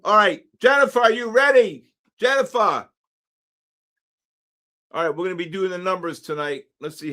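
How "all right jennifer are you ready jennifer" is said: calling out. Real, not acted. Calling out a raffle winner.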